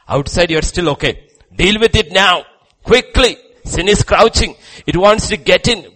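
loud, high, urgent preacher's warning; preacher urging his flock to act quickly, rising and loud; fiery preaching. Acted. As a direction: press the danger home at full force.

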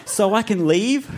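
high-pitched questioning voice; hopeful question. A narrator's reading. Boy hero asks villain to go.

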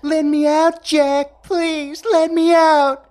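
high falsetto imitating a pleading girl; mocking. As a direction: mimic her begging in a high squeal. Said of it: "Jack imitated her voice".

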